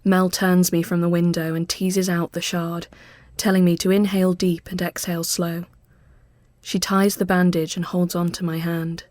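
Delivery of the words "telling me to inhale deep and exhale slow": usual speaking voice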